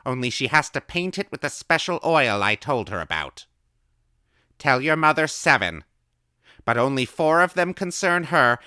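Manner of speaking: put-on high, loud old woman calling; old woman's voice. A narrator's reading. Old woman calling answers to the boy through the door.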